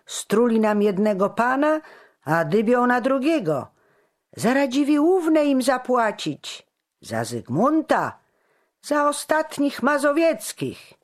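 high, loud crowd outcry; shouting. A narrator's reading. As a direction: hurl accusations from the mob. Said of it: "krzyki padały jak bomby".

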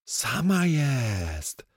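high, long drawn-out exclamation; full of enthusiasm. Acted. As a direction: crow it with glee, stretching the vowels. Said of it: "Kostek był pełen entuzjazmu". (wysoki, przeciągły okrzyk entuzjazmu)

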